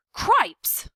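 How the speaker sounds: high, loud, breathy cry of alarm; shout. A narrator's reading. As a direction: yelp it in panic. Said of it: "shouted Furlow again".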